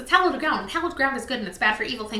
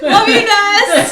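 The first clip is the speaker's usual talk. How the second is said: laughing; 7 semitones higher, 11 dB louder, much slower than usual. Real, not acted. Laughing affection after cheer.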